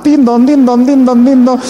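high, loud ding-dong like a church bell; mock church bell chime; bell sound. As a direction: ring it out like a bell. Kiss rings like a church bell.

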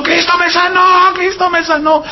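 high, sustained mock cry of joy; imitated shouting. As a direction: mimic a healed man shouting, pitched right up. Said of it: "y gritando y saltando". (imitando gritos agudos y sostenidos)

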